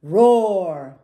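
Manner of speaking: big storybook roar, drawn out; loud, falling dinosaur roar. A narrator's reading. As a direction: roar it out loud for little listeners. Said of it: "roar the loudest roar".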